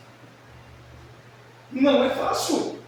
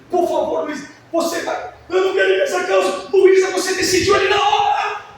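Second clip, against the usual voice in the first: exasperated yell; 8 semitones higher, 6 dB louder than usual. Retold or imitated. Father losing patience, yelling.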